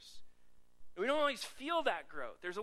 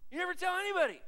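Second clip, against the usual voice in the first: shouted much higher and louder; emphatic shout; 11 semitones higher, 8 dB louder, faster than usual. Acted. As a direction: drive it home with a burst. Preacher emphatic repeat.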